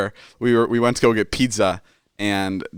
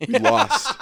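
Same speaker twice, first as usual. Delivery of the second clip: incredulous; 13 semitones higher than usual. Real, not acted. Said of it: "and we lost".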